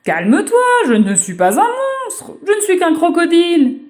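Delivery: put-on a big creature calming a child; crocodile.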